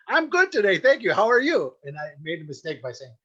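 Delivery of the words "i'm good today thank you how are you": high, loud mock cheeriness; over-polite reply in a raised singsong. Retold mock-polite reply at McDonald's.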